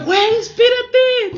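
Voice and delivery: high, loud, drawn-out call; alarmed shout. Retold or imitated. Storyteller to roommate running to open the door, alarmed shout. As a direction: shout to stop someone in a hurry.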